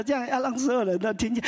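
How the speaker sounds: normal talking voice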